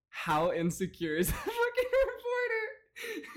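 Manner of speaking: cracking up mid-sentence, high; laughing. Real, not acted. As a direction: voice breaking into laughter. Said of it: "He's so nervous".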